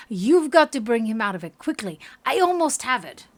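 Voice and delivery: loud, urgent character line; urgent command. Acted. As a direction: press them to act at once. Acted urgent order in story.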